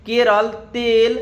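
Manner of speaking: Kerala said slowly, each syllable drawn out, then तेल; slow demo. Shown as a demonstration. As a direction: stretch out Kerala and तेल for the class. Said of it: "जैसे कि केरल".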